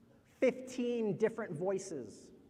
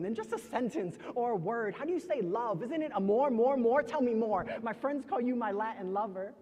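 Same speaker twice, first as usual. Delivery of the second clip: eager coaxing swelling louder on the repeated word; infatuated lover; 5 semitones higher, 6 dB louder than usual. Acted. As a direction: coax your lover, getting carried away. Lover's voice in poem.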